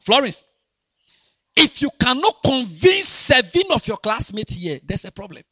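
fiery sermon shout; preaching at full force. Acted. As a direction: hammer it home to the church. Preaching to congregation.

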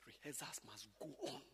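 hushed aside, no voice; whisper. Real, not acted. Pastor whispering an aside to the teachers. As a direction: whisper it like a secret.

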